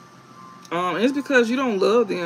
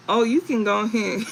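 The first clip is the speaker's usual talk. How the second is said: ordinary talk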